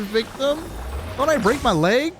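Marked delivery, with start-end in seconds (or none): none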